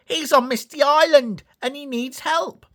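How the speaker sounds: small engine raising alarm; Percy voice. A narrator's reading. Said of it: "Percy peeped".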